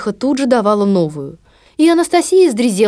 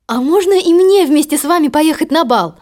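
put-on hopeful, higher girl's voice; young girl voice; 7 semitones higher, 3 dB louder than usual. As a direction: ask shyly, hoping. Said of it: "спросила Золушка".

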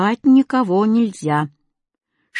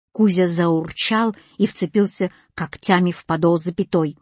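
ordinary delivery

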